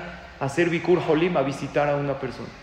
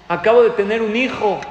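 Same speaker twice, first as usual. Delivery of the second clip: loud, higher, elated statement; retold joyful announcement; 5 semitones higher, 9 dB louder than usual. New father telling his rabbi, loud retold joy. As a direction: proclaim the happy news with a big lift. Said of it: "con una sonrisa en la boca".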